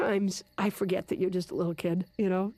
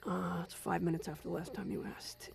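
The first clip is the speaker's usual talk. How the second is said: whispering; 5 semitones lower, 10 dB quieter than usual. Acted. Quiet nighttime search.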